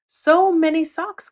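high, loud, slow exclamation; cried out. Acted. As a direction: gasp in amazement at all the socks. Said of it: "cried Fox".

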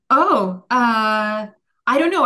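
high, loud flustered outburst. Real, not acted. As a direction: caught off guard and laughing it off. Stuck on a tough question.

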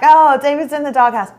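playing along brightly for show; mock-breezy, covering up the anger; light joking agreement. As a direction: act like it's all a joke. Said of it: "But seriously, what I'm thinking is".

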